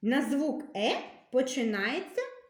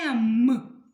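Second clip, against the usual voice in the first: letters called out louder, one at a time; loud letter reading; 6 dB louder, much slower than usual. Shown as a demonstration. Teacher calling out letters to first graders, loud. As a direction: call each letter out to the whole class. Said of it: "Читаємо другий стовпчик".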